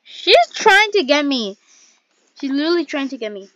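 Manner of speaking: panicked, loud and high; scared horror game streamer. Real, not acted. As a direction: cry out as she chases you. Seeker chasing her.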